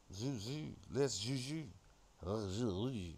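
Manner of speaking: low, soft French babble; mock Old French. Musing aloud on how Old French sounded, mock French. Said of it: "I wonder what that sounded like".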